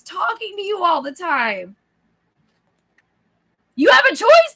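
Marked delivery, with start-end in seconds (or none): 3.8-4.5 loud, high-pitched realization; emphatic outburst. Retold or imitated. Reader reliving her first reading, loudly amazed. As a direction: burst out with it, much louder and higher. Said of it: "That was me".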